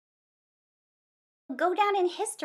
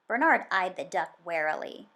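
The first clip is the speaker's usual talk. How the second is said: usual speaking voice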